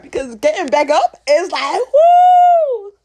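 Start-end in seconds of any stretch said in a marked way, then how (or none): 1.9-2.9 very high loud woo; excited whoop; cheer. Real, not acted.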